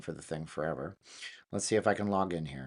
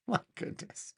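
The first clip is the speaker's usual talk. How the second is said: soft, high, breath-filled laugh on the words; laughing in disbelief; 5 dB quieter than usual. Real, not acted. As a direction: laugh it out softly, amazed. Amused amazement at cloned voice.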